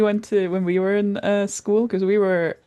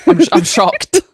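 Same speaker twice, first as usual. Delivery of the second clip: hammed-up sarcastic shock, higher and louder; mock shock; 8 semitones higher, 7 dB louder than usual. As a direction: overplay being stunned by something obvious. Sarcastic reply to obvious news.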